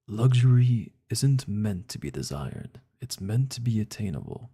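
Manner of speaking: hint of a luxury ad narrator; mock ad read. Shown as a demonstration. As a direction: read it like a posh commercial, lightly. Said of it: "read it in the way they want us to read it".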